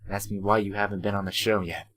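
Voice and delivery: usual speaking voice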